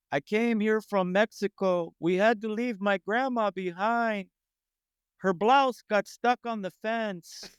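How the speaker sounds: high, slow comic persona voice; Frank Manzano stage voice. Retold or imitated. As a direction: deliver the joke in his drawn-out stage voice. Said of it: "I still assumed he talks like this".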